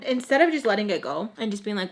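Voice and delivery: ordinary delivery, nothing marked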